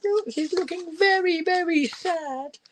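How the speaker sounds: sympathetic storyteller. Real, not acted. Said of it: "reading to children".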